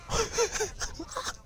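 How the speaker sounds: high-pitched helpless laugh. Real, not acted. Spectator cracks up at the player's blunder, high laughing. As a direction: burst into a high, wheezy cackle.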